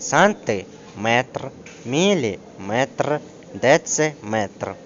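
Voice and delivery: САНТИМЕТР, МІЛІМЕТР, ДЕЦИМЕТР slowly split, beat on МЕТР; stress on METR. Shown as a demonstration. Teacher demonstrating units of length, stress on final syllable. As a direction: draw out each word and land on МЕТР. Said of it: "наголос падає на метр".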